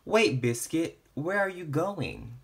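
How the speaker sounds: ordinary delivery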